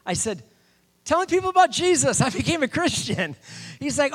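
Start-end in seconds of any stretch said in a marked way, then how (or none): 1.0-3.3 high, loud hollered announcement; shouting back. Retold or imitated. Preacher on a bench answering his buddy, loud shout. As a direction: call it out over a distance.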